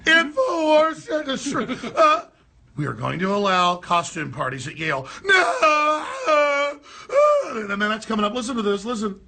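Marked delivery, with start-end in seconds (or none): 0.0-2.3 high, slow mocking wail of a protester; mocking wail, higher and louder; mock shrill protester. 2.8-6.8 mocking cry of outrage, louder; mock cry and shout; mock hysterical student.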